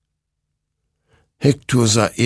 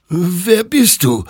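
high, slow, drawn-out whimper; frightened high-pitched whimper; 12 semitones higher, 5 dB louder, slower than usual. A narrator's reading. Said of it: "wimmerte Hektor".

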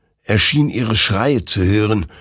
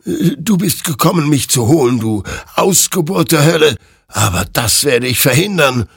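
higher, louder menacing challenge; threatening; 10 semitones higher, 6 dB louder than usual. Acted. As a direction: threaten the demon, voice raised. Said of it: "drohte Hektor". (drohend, höher und lauter)

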